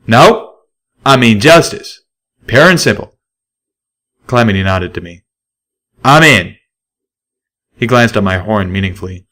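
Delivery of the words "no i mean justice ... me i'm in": a stallion declaring justice; loud sure stallion; Calamity. A narrator's reading.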